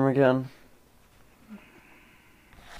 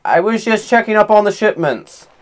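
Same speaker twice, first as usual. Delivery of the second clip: higher-pitched, lightly acted cover story; pretend worker; 5 semitones higher than usual. Player bluffing a guard as a worker, lightly put-on innocence. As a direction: pass yourself off as a worker. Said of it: "I will pretend to be a worker".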